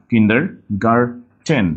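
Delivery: "কিন্ডারগার্টেন" slow, ending in a clear -ten; pronounced with T. Shown as a demonstration. Teacher correcting class, "কিন্ডারগার্টেন" slowly with -ten. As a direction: break off a crisp T in "কিন্ডারগার্টেন". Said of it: "it is not garden".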